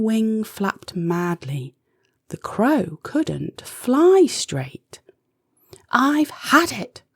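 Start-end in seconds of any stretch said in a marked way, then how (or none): 5.9-7.0 fed-up yell. Acted. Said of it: "yelled Izzy".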